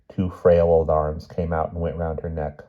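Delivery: usual speaking voice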